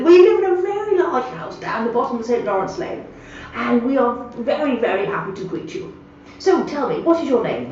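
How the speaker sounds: hammed-up posh old man greeting a newcomer; posh gentleman. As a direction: lay on the posh welcome like a retired squire.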